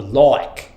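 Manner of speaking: "like" as "loike", with an OY vowel; Australian vowel. Shown as a demonstration. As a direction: say "like" low with Australian OY. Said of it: "like there's an O in the word".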